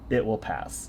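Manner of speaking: hushed calm. Retold or imitated. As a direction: say it quietly, like wisdom that needs no weight. Same reply, quieter.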